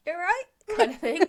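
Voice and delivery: high, tentative little greeting; sheepish imitation. Mother-in-law just walking into rooms, mock sheepish. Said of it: "just being sheepish all week".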